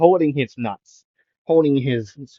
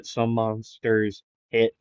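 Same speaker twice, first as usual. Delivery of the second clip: usual speaking voice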